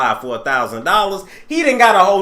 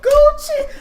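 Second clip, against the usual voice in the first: mock crying wail; 4 dB louder than usual. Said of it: "read you for crying".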